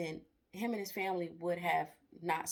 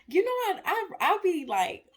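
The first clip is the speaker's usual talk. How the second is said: excited; 11 semitones higher, 9 dB louder than usual. Real, not acted. Excited aside on Bible reading.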